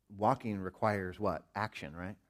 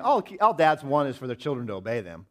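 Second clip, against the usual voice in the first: louder, insistent inner thought; a touch of emphatic; 5 semitones higher, 9 dB louder than usual. Retold or imitated. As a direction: drive the point home to yourself. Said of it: "That's what I was thinking in my mind".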